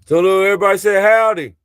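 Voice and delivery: loud, high and fast call to everyone; rally everyone to say howdy. Acted. Vlogger calling out loudly to everyone watching.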